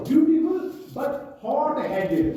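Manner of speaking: very slow, stumbling over each word; hesitant word-by-word reading; halting reading. Shown as a demonstration. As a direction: read it like a struggling student. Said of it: "अब क्या हुआ?".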